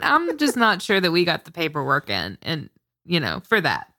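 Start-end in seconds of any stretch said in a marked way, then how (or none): none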